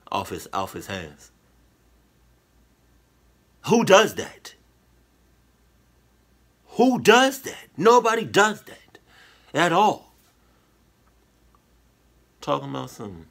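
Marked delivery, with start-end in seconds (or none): 3.7-10.1 high, loud, incredulous outburst; loud disbelief. Real, not acted. Listener reacting to the paint licking, loud disbelief. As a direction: cry out in appalled disbelief.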